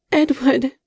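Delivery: high, loud call of his name; frantic call to her wounded love. A narrator's reading. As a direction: cry out to him across the room. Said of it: "she called again".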